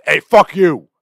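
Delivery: loud, high-pitched Brooklyn holler; gravelly Brooklynite. Acted. The old Mario as a Brooklynite, gravelly shout. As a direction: yell it like a gravelly cartoon New Yorker. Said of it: "kinda gravelly voice".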